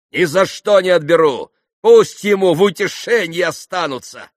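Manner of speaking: high, loud, emphatic refusal; exclaimed. A narrator's reading. Mitya leaves the pans their money, exclaiming. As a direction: declare it loudly and absolutely. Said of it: "воскликнул Митя".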